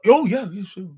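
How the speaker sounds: eager agreement. Retold or imitated. Feds answering the woman.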